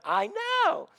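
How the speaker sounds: imitated high-pitched "I know"; high squeaky agreement. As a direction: squeak it out in sympathy. Retold agreeing reply.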